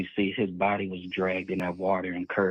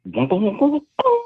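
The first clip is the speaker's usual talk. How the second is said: high-pitched booming imitation, climbing steeply; imitated boat noise; 13 semitones higher, 4 dB louder than usual. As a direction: mimic the boat's boom sound in a high voice. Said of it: "what makes the sound on the boat to go".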